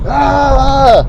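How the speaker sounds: loud, high mock scream; sustained "ah" yelled in imitation; imitated yell. Imitating a girlfriend in an argument, high loud yell. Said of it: "she was yelling".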